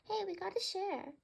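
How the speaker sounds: telling a greedy bird off; scolding bird voice. Acted. Birds bicker over food.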